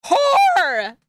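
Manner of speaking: mock jealous shout. Streamer jealous over a dinner invitation, mock outraged shout. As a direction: yell it in playful jealousy.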